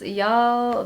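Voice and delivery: usual speaking voice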